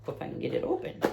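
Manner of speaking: soft whisper to herself. Real, not acted. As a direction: whisper it under your breath. Whispering while opening bag.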